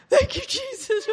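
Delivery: high, loud cry of relief; relieved cry. Retold or imitated. Preacher mimicking his relieved self to the church, crying out high. Relief after surviving family Christmas.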